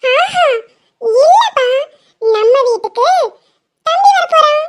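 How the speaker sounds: put-on a small girl correcting her dad; little girl voice. Child voice insisting to dad.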